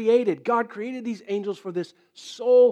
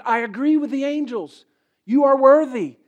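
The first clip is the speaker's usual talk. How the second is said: raised, ringing declaration; elders crying out; 5 semitones higher, 8 dB louder than usual. Acted. Elders cry worthy to God, loud and high. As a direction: cry it up to the throne. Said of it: "what they're saying is".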